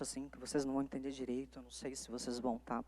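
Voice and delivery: speaking too softly. Shown as a demonstration. Lecturer to law students, demoing a voice too soft to hear. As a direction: drop the voice so they can't follow. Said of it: "falar um pouco mais baixo".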